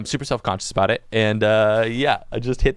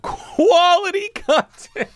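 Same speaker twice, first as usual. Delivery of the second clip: laughing; 5 dB louder, much slower than usual. Real, not acted. Mocking his own content.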